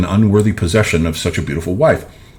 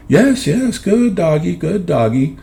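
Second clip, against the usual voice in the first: much higher, lilting and soothing, but condescending; contemptuous sing-song; 11 semitones higher, slower than usual. A narrator's reading. Wife stroking her kneeling husband, soothing him in a contemptuous sing-song. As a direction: coo at him like a pet that licks your feet. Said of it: "in the soothing, contemptuous sing-song".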